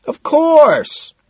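very high, loud assent; high-pitched holler; shout. A narrator's reading. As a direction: yell your agreement over the din. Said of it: "Madison shouts".

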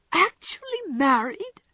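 high, drawn-out question; incredulous. Acted. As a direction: stretch it out in utter disbelief. Jo cannot believe the news.

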